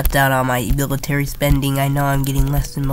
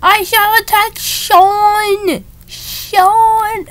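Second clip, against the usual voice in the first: hammy villain; much slower than usual. Pretend. Villainous game roleplay.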